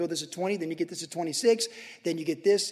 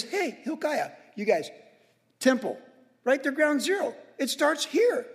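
higher, urgent commands from King Josiah; rally the priests, higher and eager; king giving orders; 7 semitones higher than usual. Retold or imitated. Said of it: "And he says".

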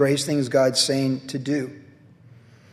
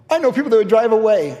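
lightly voiced Barbie; 6 semitones higher, 6 dB louder than usual. Barbie quoted.

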